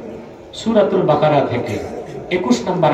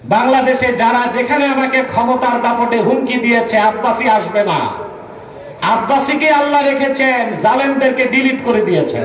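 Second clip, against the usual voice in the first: preaching at full force; 8 semitones higher than usual. Acted. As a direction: thunder defiance at the crowd. Fiery defiant sermon climax.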